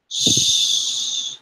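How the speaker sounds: normal talking voice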